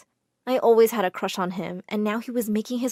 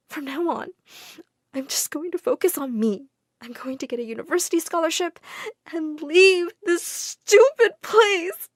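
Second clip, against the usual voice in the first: tearful resolve; 6 semitones higher than usual. A narrator's reading. Girl telling the widow her new plan, tearfully determined. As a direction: vow it through tears. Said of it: "I burst into tears and told her everything".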